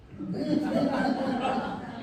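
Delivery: a bright burst of laughter; high real laugh. Real, not acted. Teller laughing at the napkin lyric, bursting out.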